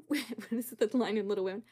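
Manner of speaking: usual speaking voice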